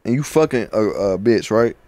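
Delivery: normal talking voice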